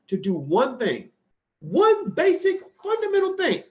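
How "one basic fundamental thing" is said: emphatic challenge. Real, not acted. Challenging doubters, rising to a high emphatic cry. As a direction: throw down the challenge, voice jumping up high. Challenging anyone who disagrees.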